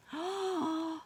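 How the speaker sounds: sharp theatrical gasp for the class. Acted. Teacher gasps at the picture for the children, mock surprise.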